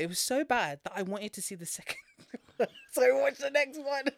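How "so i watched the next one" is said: higher, louder, giggling through it; laughing at himself for watching the sequel. Real, not acted.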